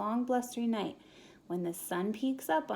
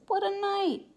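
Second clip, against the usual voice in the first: plain reading voice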